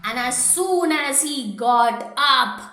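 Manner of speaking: usual speaking voice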